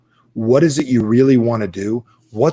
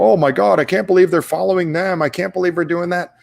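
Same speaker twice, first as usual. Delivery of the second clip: shocked complaint, raised up high; exasperated disbelief; 5 semitones higher than usual. Retold or imitated. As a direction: play the fed-up onlooker. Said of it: "You might look out there and go".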